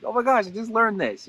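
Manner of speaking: high, eager burst of fresh news; excited. Shown as a demonstration. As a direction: tell it like you just found out. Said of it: "that whole excitement".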